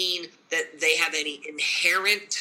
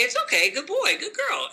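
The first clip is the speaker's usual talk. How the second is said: high, sing-song praise to a dog; gentle high dog praise; cooing praise; 9 semitones higher than usual. Pretend. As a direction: coo it like praising a puppy. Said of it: "But if you say".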